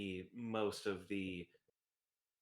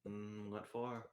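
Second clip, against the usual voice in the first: quiet character voice; 6 dB quieter, slower than usual. Acted. Zek answers in character.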